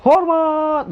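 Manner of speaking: high, stretched drill command; aba-aba hormat. Shown as a demonstration. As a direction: call the salute out to the troops. Demonstrating ceremony salute commands. (aba-aba hormat melengking dan panjang)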